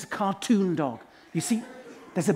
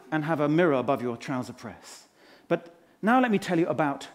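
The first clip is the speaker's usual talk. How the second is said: normal talking voice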